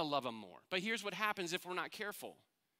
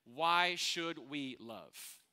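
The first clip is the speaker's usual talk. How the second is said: very slowly, weighing each word; slow, weighed question; 3 semitones lower, much slower than usual. Shown as a demonstration. Pastor poses a question to the congregation, drawn out slowly. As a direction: let the question hang slowly. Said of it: "answers the question".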